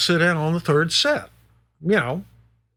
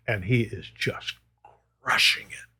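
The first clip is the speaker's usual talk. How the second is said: softer, whispered "crushing it" in awe; 5 semitones lower, 6 dB quieter than usual. Real, not acted. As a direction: breathe "crushing it" in hushed awe. Praising Steve's playing.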